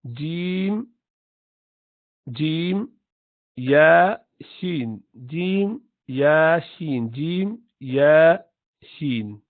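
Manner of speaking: jim, ya, shin each said clearly from the middle of the tongue; modeling the letters for students; letter drill. Shown as a demonstration. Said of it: "If you just go back".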